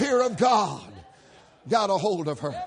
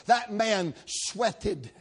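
slow mock gossip; 3 dB quieter, much slower than usual. Gossiping hairdresser retold.

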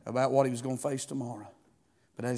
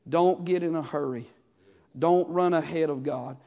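ordinary talk, nothing marked

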